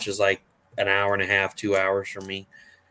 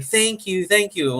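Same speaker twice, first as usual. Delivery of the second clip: high-pitched imitation of a thrilled kid; excited child; 10 semitones higher, slower than usual. Retelling the boy given a print, excited high child voice. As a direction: gush thanks in a little kid's voice. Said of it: "he was so excited".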